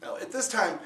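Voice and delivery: plain reading voice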